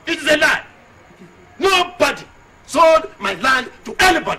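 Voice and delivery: high, very loud shouting; outraged full-volume outburst; furious denial. Acted. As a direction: shout down the accusation, hammering each phrase. Furious denial in land quarrel.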